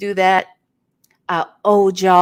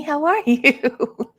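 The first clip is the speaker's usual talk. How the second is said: usual speaking voice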